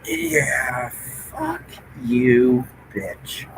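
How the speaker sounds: very soft, drawn-out muttered curse; hushed swearing. Real, not acted. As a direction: curse under your breath. Swearing under breath at teasing friend.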